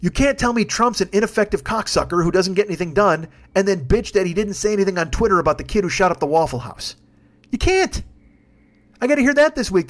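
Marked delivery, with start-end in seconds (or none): none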